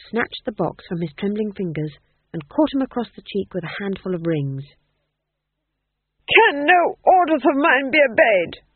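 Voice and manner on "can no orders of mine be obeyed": high, loud furious outburst; screamed. A narrator's reading. Duke rages at the disobedient Fool, screaming. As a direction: scream at a useless servant. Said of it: "he screamed".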